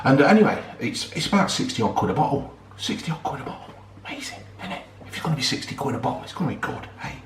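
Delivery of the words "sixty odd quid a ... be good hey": whisper. Real, not acted. Whispering about the bottle price.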